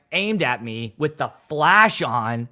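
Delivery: ordinary delivery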